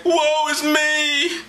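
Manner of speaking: high, long-held mock wail; theatrical high-pitched woe; hammed-up lament. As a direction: wail it slowly like a doomed prophet. Acts out Isaiah's lament.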